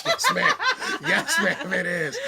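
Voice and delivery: high, hammed-up Southern yes ma'am; a playful Southern gentleman impression. That Southern drawl.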